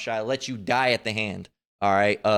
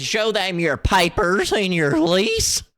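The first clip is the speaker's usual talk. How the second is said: high, slow, crackly mock imitation of the woman; higher, slow, mocking crackle; 8 semitones higher, 5 dB louder, slower than usual. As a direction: ham up a crackly voice to ridicule her. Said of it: "crackly ass voice".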